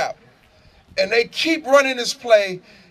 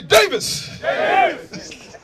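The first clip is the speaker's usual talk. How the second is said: high-pitched mock yell; high coach's holler; coach's scream; 7 semitones higher, much slower than usual. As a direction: call out the name like a screaming coach. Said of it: "so he screams".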